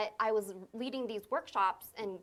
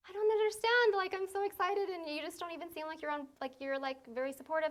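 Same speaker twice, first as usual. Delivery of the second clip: hurt and excited; 9 semitones higher than usual. Retold or imitated. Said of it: "And I was like".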